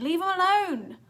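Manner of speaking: high, loud desperate scream; girl screaming at the killer. A narrator's reading. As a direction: scream to protect him. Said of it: "Clarissa screamed".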